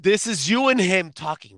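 ordinary delivery

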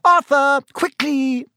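high, loud urgent cry; wife's high-pitched holler. A narrator's reading. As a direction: shout for him to hurry. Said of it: "cried Missus Weasley".

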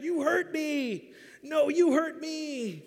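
put-on slow, high childish whine; whiny child quarrel. Family members blame each other, in a slow, high childish whine. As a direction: whine it back like bickering kids.

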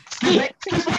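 high, loud, entitled Karen voice. Retold or imitated. Mock Karen calling a waiter over in a pub, high and loud. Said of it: "I turned into a Karen".

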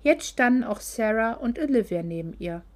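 ordinary delivery, nothing marked

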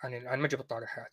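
mumbled, quick, evasive denial; a lightly voiced sheepish apologist backing off; mock defensive denial. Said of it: "حيقول لك لا،".

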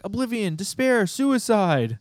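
high, loud hammed-up scream of dark words; mock screaming. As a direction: shriek each word like a tiny doom goddess. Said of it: "she's like".

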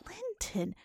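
ordinary delivery, nothing marked

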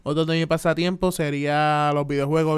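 ordinary talk, nothing marked

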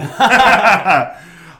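big burst of laughter; loud laugh. Real, not acted. Big laugh after story.

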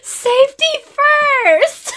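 a mother parodying her family's safety catchphrase, high squeak; mock catchphrase. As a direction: drag it out like a fussy kid's motto. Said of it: "is, like, my catchphrase in this house".

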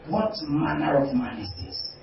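low, hushed wonder; awed disciples. Acted. Disciples amazed at Jesus calming the sea, hushed. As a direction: marvel quietly at him. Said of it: "they said".

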